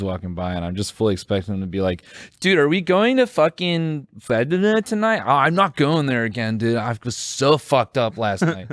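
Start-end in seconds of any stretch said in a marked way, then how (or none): 2.4-8.7 slightly higher student voice; lightly voiced party kid; hint of a hungover college kid; imitated partying kid. Imagined kids talking on street.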